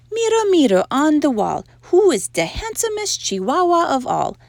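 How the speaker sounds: put-on high, loud Spanish-accented declaiming. A narrator's reading. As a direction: play a kitten's best Spanish accent. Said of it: "using his very best Spanish accent".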